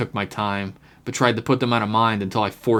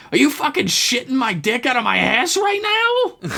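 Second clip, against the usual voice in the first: excited shriek. A narrator's reading. Hardaway still screeching in disbelief.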